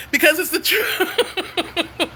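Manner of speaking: words dissolving into real laughter; laughing. Real, not acted. Moved by friend's post, laughs.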